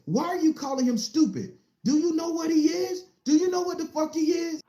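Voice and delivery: bow-tie official berating the mother, outraged and high; indignant yelling. Retold or imitated. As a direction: defend the boy, furious at the mother.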